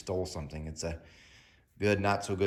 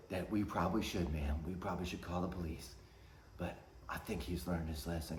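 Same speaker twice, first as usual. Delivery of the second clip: much softer, lower hesitant voice; timid manager; 4 semitones lower, 12 dB quieter than usual. Retold or imitated. Manager gently backing off to the mother, quiet. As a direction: mumble it, trying to calm her. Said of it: "the manager's like".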